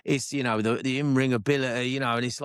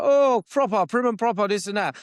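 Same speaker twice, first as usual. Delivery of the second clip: much higher, louder put-on prim-and-proper Hugh Grant type; mock prim Englishman; 8 semitones higher, 4 dB louder than usual. Mocking how Americans picture the English, hammed-up prim voice. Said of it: "they just think everyone's like".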